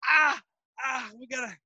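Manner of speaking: high pained yelps, then rushed; hammed-up pain, hurrying off; faking pain. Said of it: "I was like".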